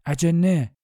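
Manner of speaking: dwarf's shouted warning; shouted cry. A narrator's reading. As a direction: cry it out to the whole troop. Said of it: "یکی از کوتوله ها فریاد زد".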